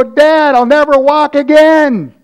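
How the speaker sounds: high, loud mock wail; hammed-up hurt child. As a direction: wail to dad like a hurt kid. Acted hurt child wailing to father.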